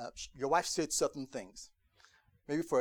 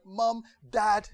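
high eager call to parents; excited child calling out, higher and louder; 7 semitones higher, 9 dB louder than usual. Retold or imitated. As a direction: call out to mom and dad, thrilled.

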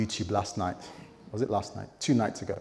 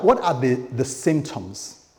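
ordinary talk

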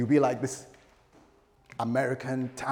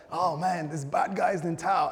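slightly higher, lightly acted panic; mock townsfolk alarm; 5 semitones higher than usual. Townsperson warning of the gunman, mildly alarmed. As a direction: play a worried townsperson spotting trouble. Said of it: "people would be scrambling all over".

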